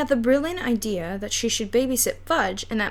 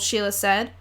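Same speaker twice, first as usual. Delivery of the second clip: ordinary delivery, nothing marked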